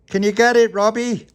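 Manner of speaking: high, loud call across water. Acted. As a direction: shout to someone far off. Calling across the lake.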